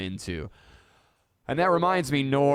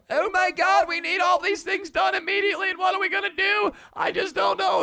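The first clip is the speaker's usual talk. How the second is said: much higher and louder, a frantic shouting producer; panicked producer; 7 dB louder than usual. Retold or imitated. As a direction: play the producer in full panic, yelling. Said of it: "and going".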